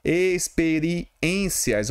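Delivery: Experiências drawn out very slowly, falling; teacher spelling out Experiências slowly; slow word demo. Shown as a demonstration. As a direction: pull Experiências apart syllable by syllable. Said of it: "a regra da palavra experiência?".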